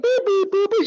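high, clipped beep beep; high mock dial tones; imitated dial beeps. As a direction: mimic phone keys being dialled.

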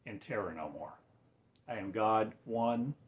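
normal talking voice

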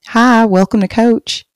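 put-on a cheery store greeter with a Texas accent; her Texas accent. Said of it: "they loved that accent that I had".

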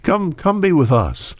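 high, eager calling out; hollering to Jesus from the doorway; high excited call to come in. Acted. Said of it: "Martha hollers out".

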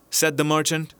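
ordinary delivery

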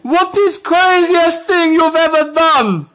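very high, slow put-on voice; hammed-up high-pitched impression, drawn out; Nat Nat. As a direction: squeal it slowly in a silly caricature voice. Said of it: "do you want it in Nat Nat's voice?".

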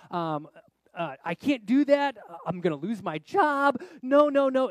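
high, very loud, drawn-out protest; alarmed refusal. Retold or imitated. Youth pastor fearing for his job, alarmed refusal. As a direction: object loudly, as if your job depends on it.

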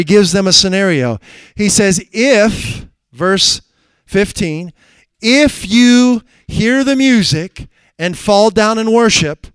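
loud, high, slow preacher's reading of the king's threat; fiery sermon delivery; preaching at full force. Acted. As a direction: thunder out the scripture.

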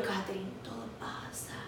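calm her down softly and slowly; hushed comfort for a friend in trouble; soothing reassurance. Retold or imitated.

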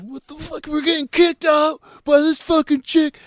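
high-pitched mock outrage; pissed off. Guy retelling his reaction to getting kicked out, mock pissed-off. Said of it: "Getting pissed off".

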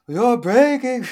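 high, loud melodramatic movie line; Tommy Wiseau. Acted. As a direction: wail it in a hammy accent. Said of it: "Every time I do a Tommy Wiseau accent".

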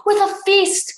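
high, loud burst of delight; excited cry. A narrator's reading. Clay jumps in at thought of food, excited. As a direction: blurt it out gleefully in character. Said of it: "Clay cried".

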